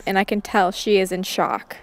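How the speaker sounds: plain reading voice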